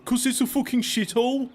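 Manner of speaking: high-pitched mock Brummie accent. Brummie explaining why he left Birmingham, Brummie accent. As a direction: put on a Brummie, voice lifted high. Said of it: "I was talking to a Brummie".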